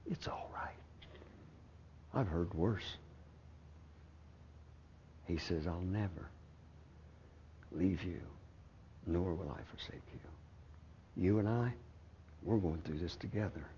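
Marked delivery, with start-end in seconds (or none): 0.1-11.2 whispered comfort. Retold or imitated. God reassuring him in the hospital room, hushed whisper. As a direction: breathe it close and tender.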